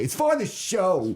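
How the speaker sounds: drawn-out, slow and high "It's for the show"; slow innocent protest; mock innocent excuse. Future excuse to his wife, a drawn-out high plea. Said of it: "I'm gonna say".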